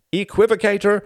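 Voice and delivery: high, loud mocking accusation; teasing shouted jab; mock cry. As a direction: fling the word like an insult, mimicking a friend. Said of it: "Cried [name], imitating Martin's tone".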